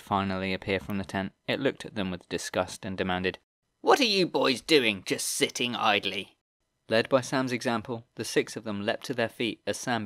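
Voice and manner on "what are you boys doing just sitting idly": high, whiny sneering goblin voice; screeching whiny goblin. Acted. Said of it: "with his screeching, whiny voice".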